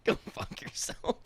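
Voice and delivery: hissed under the breath, unvoiced; whisper. Real, not acted. Teasing his friend back, a whispered go fuck yourself. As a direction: whisper it back as a playful jab.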